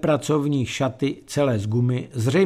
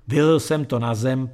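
ordinary delivery

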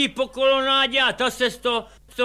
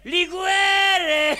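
higher, louder shout; tipsy shout for more liqueur; shouted demand; 6 semitones higher, 4 dB louder than usual. Retold or imitated. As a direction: yell it across the shop. Repeat, now shouted.

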